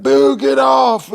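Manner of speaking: high, loud, drawn-out heckle; heckling shout. Retold or imitated. Heckler to comic on stage, high drawn-out shout. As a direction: yell it slowly from the back row. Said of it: "in the back of the room and heckle".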